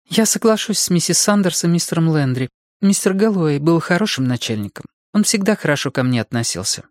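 lifted character voice, measured delivery; Judy, agreeing employee. A narrator's reading. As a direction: pitch up to play a polite junior employee.

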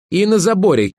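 high-pitched jab in a quarrel; sarcastic retort. Acted. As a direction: toss it in as a needling comeback.

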